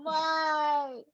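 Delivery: high, drawn-out mock wail; exasperated wail. Host lamenting the uncaught killer, high exasperated wail. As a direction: wail it in hammed-up frustration, way up high.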